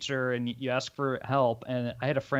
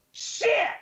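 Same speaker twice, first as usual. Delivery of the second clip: high sharp curse; frustrated shout; 4 dB louder than usual. Retold or imitated. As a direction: yelp it out of frustration. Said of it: "hear him go".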